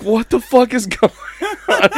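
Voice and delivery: panicked what the fuck. Retold or imitated. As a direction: caught off guard, freaking out.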